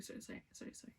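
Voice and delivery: breathless whispered apologies; whispered sorry. Real, not acted. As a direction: whisper the sorries under your breath. Apologising, embarrassed, under breath.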